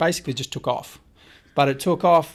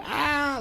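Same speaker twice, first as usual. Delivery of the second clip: high-pitched retold "aah"; imitated scream of fright; 13 semitones higher than usual. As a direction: re-enact a startled shriek. Imitates own scream at lunging shark.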